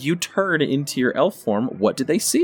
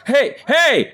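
high, loud "hey" at a prisoner; acted indignant holler; angry shout; 11 semitones higher, 5 dB louder than usual. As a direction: yell to shut someone up. Henchman yells at caged prisoner.

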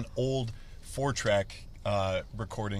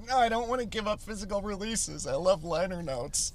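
mock whiny fan; 11 semitones higher than usual.

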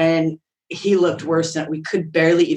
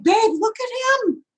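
high, thrilled urging; excited. Retold or imitated. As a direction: show him the dog breathlessly. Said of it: "I'm like".

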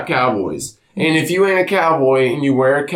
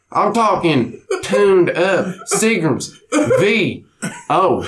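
improv of his dad; 2 semitones higher than usual. Retold or imitated. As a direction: rant like a tipsy country father lecturing his boy. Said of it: "improv of my dad, Walt".

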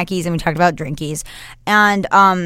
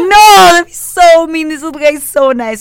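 pleading; 10 semitones higher, 10 dB louder than usual. Retold or imitated. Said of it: "And I was like".

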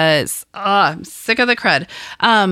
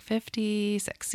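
"Fifty-six" slowly and very softly, as if unsure; questioning; 3 semitones higher, 13 dB quieter, slower than usual. Shown as a demonstration. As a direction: stretch "Fifty-six" into a hushed, hesitant question. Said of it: "I said that in a questioning manner".

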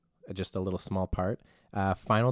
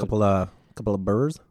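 put-on Canadian accent; 4 dB louder than usual. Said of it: "I'll do one too then".

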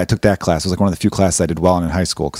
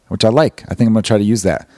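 normal talking voice

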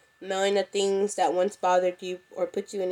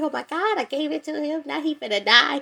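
high, panicky retold worry; mock panic; 7 semitones higher than usual. To the audience about her sick dad, mock panic. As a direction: replay the fear he'd die.

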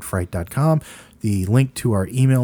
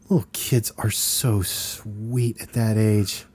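soft fond remark; 6 dB quieter, slower than usual. Retold or imitated. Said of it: "the bag boy goes".

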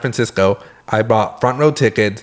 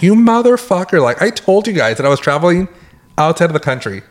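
angry rant; 7 semitones higher than usual. Retold or imitated. Yelling at the bank over blocked card, furious. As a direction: blow up at them, pitch flying up. Furious at bank's fraud texts.

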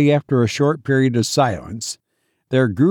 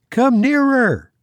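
calling out; 12 semitones higher than usual. A narrator's reading. Will calls the rowing stranger closer, calling out. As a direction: beckon a boat across water. Will hails the approaching boat.

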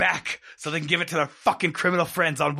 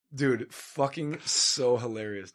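ordinary delivery

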